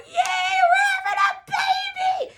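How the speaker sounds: high, loud celebratory squeal; very excited. Retold or imitated. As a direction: shout the happy news at the top of your voice. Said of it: "I was very excited".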